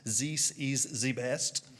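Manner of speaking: French accent with th turned to z; fake French accent. Shown as a demonstration. Showing listeners how to fake a French accent. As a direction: put on a slow, simple French accent. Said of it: "If you wanna fake a French accent".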